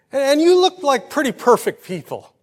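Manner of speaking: high and loud, a lightly voiced admiring lady; admiring compliment. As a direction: exclaim it like a delighted stranger.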